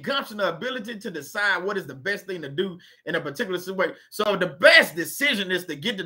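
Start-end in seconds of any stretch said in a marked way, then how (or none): none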